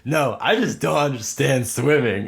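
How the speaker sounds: put-on kid voice. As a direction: insist like a kid owning his failure. Kid insisting he's dumb.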